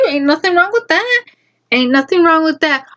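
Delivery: sing-song mock reassurance; mock innocence. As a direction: play dumb and sweet to make him squirm. Mocking the guy's answer.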